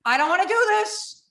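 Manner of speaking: high, pleading mock terror; retold panic. Recalling the moment at the open plane door, high panicky pleading. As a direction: beg not to be pushed out.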